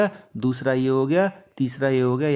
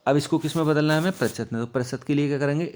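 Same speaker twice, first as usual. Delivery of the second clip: ordinary talk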